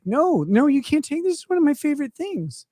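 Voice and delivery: high-pitched, mock-distraught objection; pleading protest. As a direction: protest as if it's being taken from you. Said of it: "I was like".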